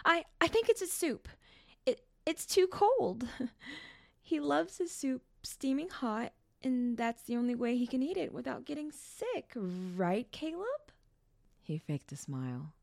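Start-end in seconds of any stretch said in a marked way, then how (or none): 0.0-3.5 put-on high-pitched, stammering girl; quick nervous outburst. A narrator's reading. Girl covering for her brother, high nervous outburst. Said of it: "Janet quickly shouted". 4.2-10.9 put-on high, anxious girl's voice; nervous covering. A narrator's reading. Janet covering for Caleb, high and nervous.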